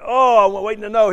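high, loud mock-eager outburst; excited impatience. Moviegoer left hanging for the next film, excited impatient outburst. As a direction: burst out like you can't stand waiting. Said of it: "you get so excited".

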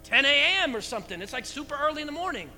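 high, loud offhand protest; defending disciples. Retold or imitated. Apostles answer the crowd, casual protest. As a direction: wave it off, they're not drunk. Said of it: "And they said".

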